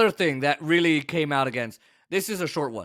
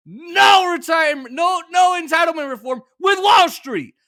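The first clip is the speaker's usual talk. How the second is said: angry shout; 11 semitones higher, 7 dB louder than usual. Real, not acted. Host yelling about Wall Street breaks, angry shout. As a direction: hammer the hypocrisy home.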